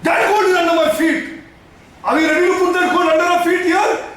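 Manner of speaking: high-pitched, insistent sermon voice; impassioned preaching. Real, not acted. Preacher challenging people to put gold underfoot, impassioned.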